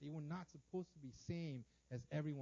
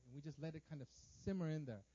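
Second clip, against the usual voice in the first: ordinary delivery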